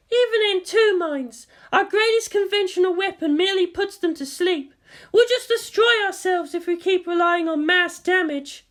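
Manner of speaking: put-on high young woman's voice; Maya. A narrator's reading. Maya to the command staff, high voiced. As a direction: explain the problem earnestly.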